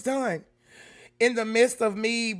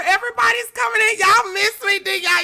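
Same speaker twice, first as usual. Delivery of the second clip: high excited chatter; 11 semitones higher, 7 dB louder than usual. Real, not acted. Thrilled as viewers pour in.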